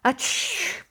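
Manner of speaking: put-on a little girl with a cold who can't help sneezing; sneeze. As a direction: let out a childish achoo. Sister sneezes again.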